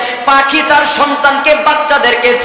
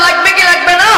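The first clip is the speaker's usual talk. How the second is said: rousing call; 5 semitones higher, faster than usual. Real, not acted. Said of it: "একটা আওয়াজ করে বলেন".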